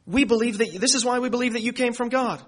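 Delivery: high, rushed, excited confession; eager disciples. Acted. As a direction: blurt it out quick and bright. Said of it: "the disciples say".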